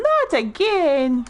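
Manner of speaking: high, drawn-out mock dismay; imitating a voter's weary outcry; exasperated woman imitation. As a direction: stretch it into a high mock wail. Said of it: "the woman that goes".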